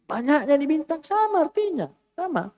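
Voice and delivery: high, amazed exclamatory reading; exclamation of wonder. Shown as a demonstration. Teacher shows the wonder tune to the class. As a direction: say it like you're marvelling. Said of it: "Jangan beda intonasinya lah".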